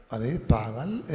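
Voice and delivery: normal talking voice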